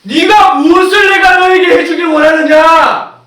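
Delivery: high, slow, full-force proclamation; booming divine question. Acted. Jesus answers the blind man, booming question. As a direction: call out a solemn question at full voice.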